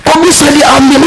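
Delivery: high mock wailing question; mock lament. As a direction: play the grieving protester lightly. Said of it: "dia meratap tuh". (ratapan pura-pura bernada tinggi)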